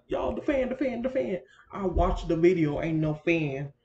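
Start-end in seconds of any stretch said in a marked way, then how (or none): 0.1-1.4 higher and louder, fussing over the fan; mock-frantic repeat; mock panic. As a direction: replay your own fuss, urgently. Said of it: "I was like".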